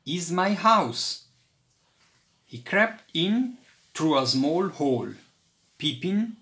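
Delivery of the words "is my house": raised proud announcement. A narrator's reading. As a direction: show off your home. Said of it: "said Toby proudly".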